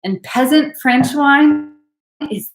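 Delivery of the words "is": whispered aside, very soft; confiding whisper. Real, not acted. Host confides love of peasant French wine, confiding whisper. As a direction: drop into a secretive whisper.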